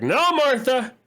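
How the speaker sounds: high, loud shout at a patient; nurse yelling. Retold or imitated. Nurse calling from the station to patient Martha, loud shout. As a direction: bark it across the ward like a nurse. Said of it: "the nurse from the station is like".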